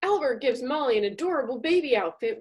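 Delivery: ordinary talk, nothing marked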